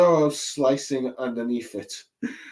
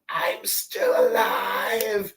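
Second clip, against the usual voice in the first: slow, higher faint whisper; weak Usopp; 8 semitones higher, much slower than usual. Retold or imitated. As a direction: barely rasp out that you survived. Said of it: "But Usopp goes like".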